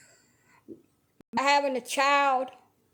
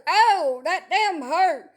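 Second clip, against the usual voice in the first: loud, high, slow cry of hurt; hollering in pain; 6 semitones higher, 4 dB louder, much slower than usual. Retold or imitated. Patient in hospital yelling at the nurse, loud pained holler. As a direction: holler it like the needle just went in. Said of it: "when you holler".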